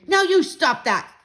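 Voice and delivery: loud, slow stern shout; father yelling. Acted. Father yelling at Fudge over the flung spoon, stern shout. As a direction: bark it at a misbehaving toddler. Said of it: "my father yelled at Fudge".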